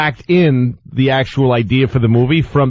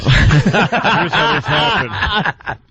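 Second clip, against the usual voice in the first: slow, higher, laughing through it; can't get the words out for laughing; 6 semitones higher, much slower than usual. Real, not acted. Host starting the story, laughing slowly.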